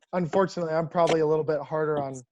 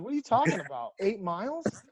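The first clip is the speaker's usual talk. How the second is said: mock disbelief, high and rising at the end; incredulous questioning; 5 semitones higher than usual. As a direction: question the sentence in retold disbelief. Player questions the punishment, retold.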